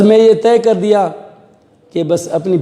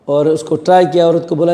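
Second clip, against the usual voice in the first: ordinary delivery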